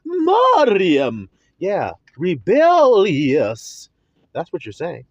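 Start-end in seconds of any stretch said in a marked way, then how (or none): 0.0-1.3 "Mariam" high and loud with a long rolled R. Shown as a demonstration. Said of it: "go ahead and roll the R some more". 2.2-3.9 "rebellious" very slow and loud, middle syllable swelling; drawn-out emphasis. Shown as a demonstration. Mimics the rolled, drawn-out name.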